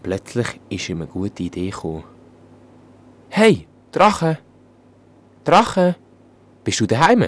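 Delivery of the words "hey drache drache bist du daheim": high, loud shout toward the castle; calling out loud. Acted. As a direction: call up to the dragon at full voice. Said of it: "hat er gerufen".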